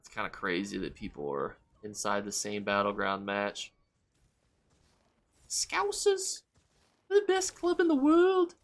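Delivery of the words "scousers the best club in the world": very high, squeaky attempt at Scouse; badly faked Liverpool accent, pitched way up; mock a Scouser in falsetto. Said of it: "I can't do a good Scousers accent".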